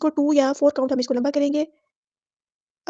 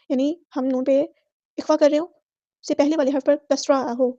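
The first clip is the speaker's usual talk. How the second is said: ordinary talk, nothing marked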